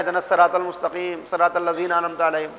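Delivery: "الصراط" said in passing, its ص unemphasized; careless run-on recitation. Acted. Someone praying unaware of the letters, careless run-on recitation. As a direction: rattle through "الصراط المستقیم" softly, without articulating each letter. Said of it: "پڑھتے چلے آ رہے ہیں".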